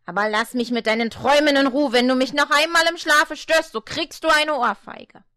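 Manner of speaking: loud, fast angry threat. Acted. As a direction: snap at her to let him sleep. Devil threatens grandmother.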